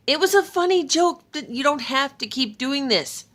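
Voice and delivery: high, loud pleading; exasperated plea. Acted. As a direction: beg him to stop the game. Thor pleads, then demands.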